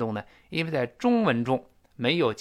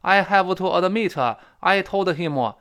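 put-on loud, higher Chinese-accented English, every syllable punched; mock Chinese accent, syllable by syllable; 5 semitones higher, 7 dB louder, slower than usual. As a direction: imitate heavy Chinese-accented English with added vowels. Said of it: "发音像中国话".

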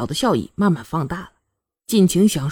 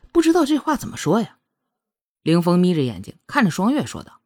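ordinary delivery, nothing marked

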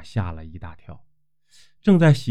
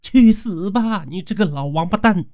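cursing; 9 semitones higher than usual. Acted. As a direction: spit the curse at him after the kick. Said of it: "嘴里骂道".

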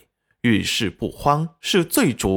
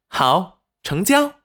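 high, snappy acceptance; eager agreement; 7 semitones higher, slower than usual. A narrator's reading. Wife accepts her husband's money, eagerly. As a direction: jump at the offer, pitched right up.

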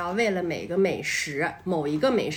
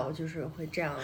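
ordinary talk, nothing marked

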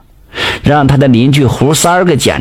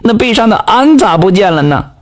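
惊讶地喊; 7 semitones higher than usual. A narrator's reading. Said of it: "老伴就喊了".

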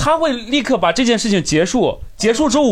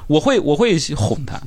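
ordinary talk

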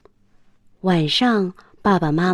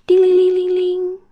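high sung-out ring of a telephone; phone ring imitation; 12 semitones higher, 5 dB louder than usual. A narrator's reading. Narrator imitating the phone ringing at home, high and bright.

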